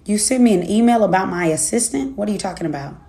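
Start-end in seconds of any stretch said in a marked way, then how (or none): none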